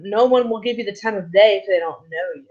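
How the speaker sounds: normal talking voice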